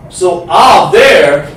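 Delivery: "Ah" called out loud and slow, open a as in father; loud drawn-out open "Ah" sound; short ah. Shown as a demonstration. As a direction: shout the open "Ah" slowly. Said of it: "is actually a short sound".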